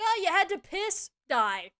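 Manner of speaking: high, loud question dropping to a blunt verdict; scornful imitation of a scolding; mock outrage. Management scolding employee for bathroom break, mock outraged. Mocks the store's harsh attitude.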